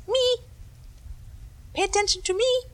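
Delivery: very high, insistent little voice; voice of a thought. Pretend. A thought demanding attention.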